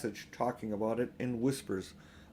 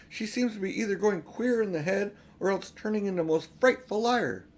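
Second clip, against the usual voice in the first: high, loud reproach; angry lecture; 8 semitones higher, 5 dB louder than usual. A narrator's reading. Peter rebuking Edmund, high and loud. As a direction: keep hammering him.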